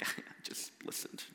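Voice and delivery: near-whispered confession; very soft, voiceless admission; sheepish aside. Retold or imitated. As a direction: drop to a tiny, embarrassed murmur. Sheepish aside answering effusive thanks.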